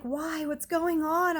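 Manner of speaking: high-pitched, rising panic; alarmed plea. Retold or imitated. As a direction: beg for an answer, scared. Frightened reply to police at door.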